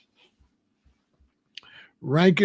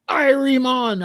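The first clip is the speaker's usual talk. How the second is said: IRIE drawn high in Jamaican accent; mock Jamaican accent; 9 semitones higher, slower than usual. Shown as a demonstration. Showing what he would never say, Jamaican accent. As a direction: exaggerate a high-pitched Jamaican greeting. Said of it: "I wouldn't go".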